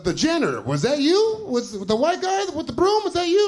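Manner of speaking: high-pitched mock disbelief; puzzled interviewer. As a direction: squeak out baffled questions as the interviewer. Voices puzzled job interviewer.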